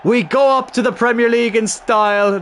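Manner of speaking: high, slow, ringing title call; building from pleading to triumphant roar; triumphant shout. Real, not acted. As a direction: proclaim the championship win. Final whistle, title won.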